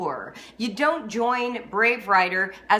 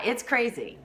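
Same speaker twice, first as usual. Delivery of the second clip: usual speaking voice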